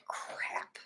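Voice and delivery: breathy whispered Crap under the breath; a hushed annoyed whisper as he hauls junk in; whispered curse. Retold or imitated. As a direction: mutter it so he won't hear. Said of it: "I'm like".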